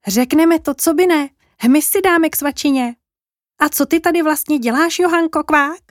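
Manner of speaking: high-pitched friendly little frog. Acted. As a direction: chirp it like a curious small frog. Frogs answer Johanka.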